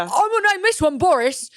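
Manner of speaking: put-on name the baby like a Brit; hammed-up British voice; mock British accent. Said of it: "you just did right there".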